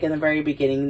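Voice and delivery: ordinary talk, nothing marked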